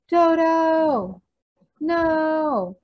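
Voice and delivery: high, loud drawn-out story-book cry; crying out in alarm. A narrator's reading. Cave Boy sees Dodo in the stampede, crying out. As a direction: call desperately after the pet.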